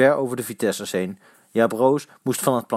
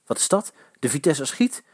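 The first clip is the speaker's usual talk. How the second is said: ordinary delivery, nothing marked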